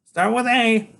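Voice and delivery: put-on small boy waking his big friend for a quest; eager boy genius. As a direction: rouse him like a tiny herald. Freak voiced.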